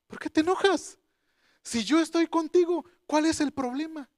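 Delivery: high-pitched, insistent questioning; voice of God. Retold or imitated. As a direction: repeat it lifted high, as God reasoning with someone. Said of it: "y entonces la voz de Dios".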